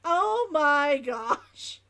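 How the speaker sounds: loud, very slow astonishment; drawn-out exclamation. Real, not acted. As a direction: stretch every word out in disbelief. Exclaims at her own reading.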